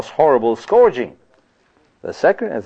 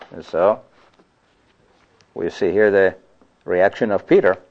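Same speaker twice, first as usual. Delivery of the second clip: normal talking voice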